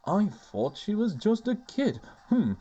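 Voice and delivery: silly Miranda voice. Acted. Miranda musing on how old Winglies are, silly voice. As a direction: make her lightly silly, pitch swooping up. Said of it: "go even sillier with your voice".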